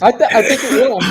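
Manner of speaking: talking while eating lunch; mouth full. Real, not acted. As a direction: speak through a mouthful of food. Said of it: "I'm eating my lunch".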